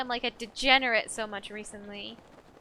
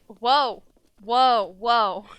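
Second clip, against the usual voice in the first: loud, drawn-out startled whoas; 10 dB louder, much slower than usual. Real, not acted. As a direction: caught off guard, shout it. Sudden scare in the game.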